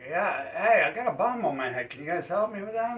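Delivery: put-on a man with a bomb on his head loudly begging the kids for help; exasperated plea. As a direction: call over to the kids, loud and fast and fed up. Bomb victim pleads with playing kids.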